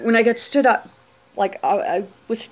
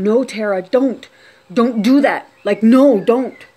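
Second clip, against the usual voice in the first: retold urgent plea, louder on the no's; firm, pleading warning in a retelling; 3 semitones higher, 6 dB louder, slower than usual. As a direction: imitate voices urging someone to stop. Retold voices urging her to stop.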